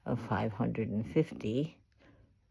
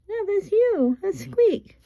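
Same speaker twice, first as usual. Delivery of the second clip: coaxing pet talk; 7 dB louder than usual. Real, not acted. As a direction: quiz a pet bird in a very high voice. Talking to the parrot.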